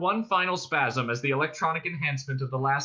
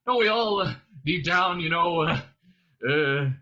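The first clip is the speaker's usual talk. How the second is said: louder, halting appeasement; nervous; 3 semitones higher, 5 dB louder than usual. A narrator's reading. Ford tries to win over the Vogon, nervously. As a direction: start high and eager, then falter into uhs. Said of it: "Ford laughed a nervous laugh".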